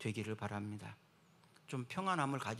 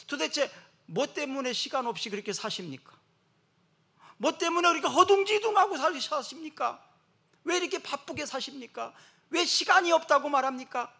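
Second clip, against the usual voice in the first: high, loud rhetorical challenge; fiery sermon questions; preaching at full force; 12 semitones higher, 9 dB louder than usual. Acted. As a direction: press the congregation hard.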